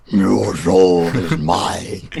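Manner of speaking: low, slow demonic voice; devil voice. Retold or imitated. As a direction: growl it like the devil. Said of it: "they just hear".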